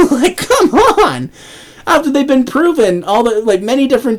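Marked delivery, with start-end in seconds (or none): 0.1-1.3 laughing, pitched way up, in disbelief; a high cracking laugh on come on. Real, not acted. Ranting vlogger laughs at media hypocrisy, high disbelieving laugh.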